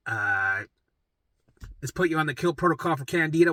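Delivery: ordinary delivery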